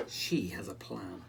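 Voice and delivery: ordinary delivery, nothing marked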